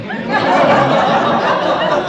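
breathy stifled chuckle; amused chuckle. Real, not acted. Narrator chuckling at the scene. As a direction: laugh under the breath at the joke.

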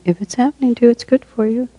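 high, lilting playful delivery; playful saying. Shown as a demonstration. Teacher offers a favorite saying to the group, playfully. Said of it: "I love to say it like that".

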